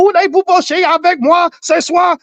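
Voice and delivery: high-pitched pretend French rant; very high, comic French outburst; mock French yelling. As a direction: imitate a woman hollering in bad French. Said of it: "she starts yelling and screaming in French".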